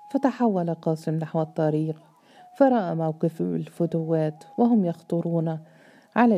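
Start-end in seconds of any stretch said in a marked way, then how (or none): none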